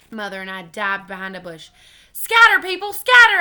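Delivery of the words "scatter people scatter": high, very loud alarm; yelled. A narrator's reading. Child warning people about the witch, frantic yell. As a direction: yell a warning to everyone around. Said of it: "I yelled".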